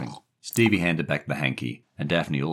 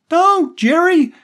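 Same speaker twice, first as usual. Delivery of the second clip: very high, loud drawn-out cry; scream; 14 dB louder, much slower than usual. A narrator's reading. Daphne stopping Jerry from hitting the boy, screaming. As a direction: scream to stop him. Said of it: "Daphne screamed".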